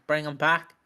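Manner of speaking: normal talking voice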